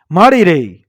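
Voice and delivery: plain reading voice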